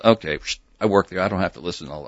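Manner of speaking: usual speaking voice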